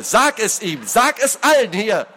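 high, insistent angry command; demanding. A narrator's reading. Lord demanding a public confession, angrily. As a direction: drive the demand home. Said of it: "forderte der Adlige".

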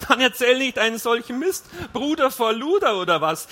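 loud, high, scornful scolding; angry retort. A narrator's reading. Wife rebukes husband for his nonsense, angry and loud. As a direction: snap back loudly at him. Wife snaps back in quarrel.

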